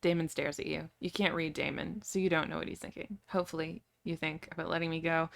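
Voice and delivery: ordinary delivery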